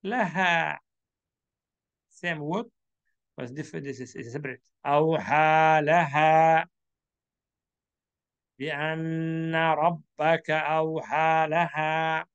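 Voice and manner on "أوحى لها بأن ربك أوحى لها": مرتّل بالتجويد; Quran recitation. Shown as a demonstration. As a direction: recite the verse in tajwid style.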